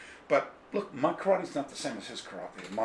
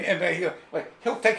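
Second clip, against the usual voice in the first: ordinary talk, nothing marked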